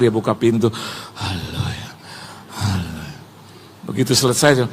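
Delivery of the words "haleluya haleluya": low, soft aside; hushed winded praise. Retold or imitated. Winded pastor gets a rest as door opens, low hushed relief. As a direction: let the praise sink low and quiet.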